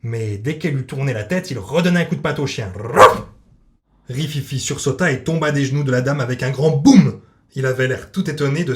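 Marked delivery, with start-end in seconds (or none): 2.8-3.2 a sudden loud barked woof; dog bark. A narrator's reading. Narrator barks as the little dog.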